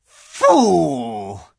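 loud, high savage snarl. Acted. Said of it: "savage smile that was almost a snarl".

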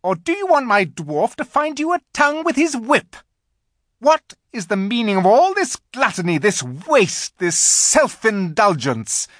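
high, loud contemptuous order; witch's command. A narrator's reading. Witch demanding the silent animals answer. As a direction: spit the insult down at them. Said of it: "she said again".

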